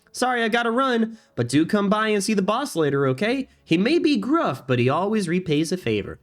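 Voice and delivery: high, sing-song character voice; friendly game character. Acted. Errand-runner saying goodbye to Goro, high friendly voice. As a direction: sign off cheerily in a high-pitched cartoon voice.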